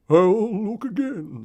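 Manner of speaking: high, loud and slow little bird voice; Mister Weaver voice. Acted. Mister Weaver promising his wife to search, high slow bird voice.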